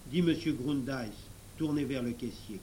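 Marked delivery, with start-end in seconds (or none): none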